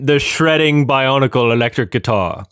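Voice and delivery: lightly copying his friend's "The shredding bionicle electric guitar."; mimicking co-host. Shown as a demonstration. Repeating his co-host's line to match his voice. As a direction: echo the line the way he said it. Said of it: "I'll try to match".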